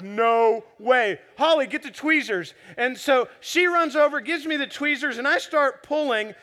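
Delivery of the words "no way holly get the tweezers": louder, reenacted disbelief turning urgent; retold shock, calling across the house; astonished call-out. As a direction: call out urgently for help in amazement.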